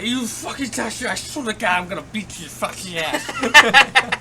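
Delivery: put-on high, loud, slowing drunken threat; drunk shouted threat. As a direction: bellow it like a drunk hothead.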